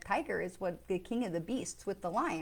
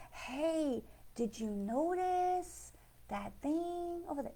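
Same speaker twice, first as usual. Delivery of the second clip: the voice of a sign; 5 semitones higher, 5 dB quieter, much slower than usual. Pretend. A sign calling for attention.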